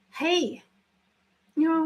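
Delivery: normal talking voice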